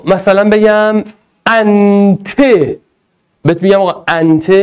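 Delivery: ordinary talk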